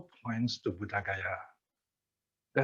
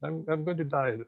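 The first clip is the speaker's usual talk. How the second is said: a frightened plea in a raised pitch, half-laughing; retold terrified shout; 6 semitones higher, 4 dB quieter than usual. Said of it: "he shouted to Upagupta".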